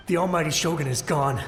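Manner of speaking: ordinary talk, nothing marked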